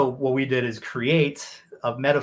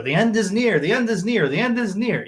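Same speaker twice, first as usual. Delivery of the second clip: raised, mock-dramatic doomsday prophet chant; 7 semitones higher, 3 dB louder than usual. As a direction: ham it up like a street-corner prophet. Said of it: "we're making fun of that guy".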